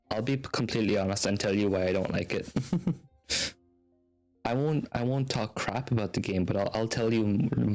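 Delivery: ordinary talk, nothing marked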